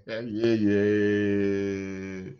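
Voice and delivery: usual speaking voice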